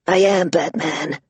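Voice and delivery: lightly rasped fake Batman growl; a hint of gravelly Batman. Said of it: "Vero rasped".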